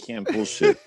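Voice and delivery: put-on squeaky high teen whine; high mock whine. Mocking a grounded kid's excuse, high whine. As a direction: pitch it way up like a whiny teen.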